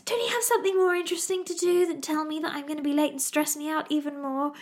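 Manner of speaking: exasperated retort. Retold or imitated. Retorting to nagging husband.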